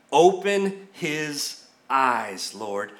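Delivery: very slow, deliberate prayer, dropping at the end. Acted. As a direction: read it out as a measured plea to God. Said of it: "here's what Elijah prays".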